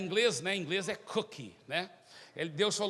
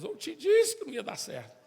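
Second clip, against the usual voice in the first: complaining to God; 8 semitones higher, 6 dB louder than usual. Acted.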